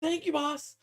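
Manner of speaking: very high little minion voice; imitated tiny grot; grateful grot. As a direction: gush thanks in a squeaky voice. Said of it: "he's like".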